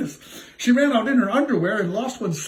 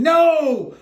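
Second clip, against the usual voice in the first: high, loud scream of no; mock scream; 9 semitones higher, 5 dB louder than usual. Prince screams as the ugly sister tries the shoe. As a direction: shriek it in horror. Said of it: "the prince screamed".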